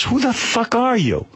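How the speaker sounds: high, slow mock annoyance; annoyed Tracy. Tracy losing patience with the stranger, slow and high. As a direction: spell out each word, fed up. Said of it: "So then Tracy goes".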